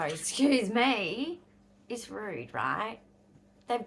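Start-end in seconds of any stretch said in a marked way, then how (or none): 0.0-1.4 indignant surprise. Retold or imitated. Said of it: "the other specialists find out and go".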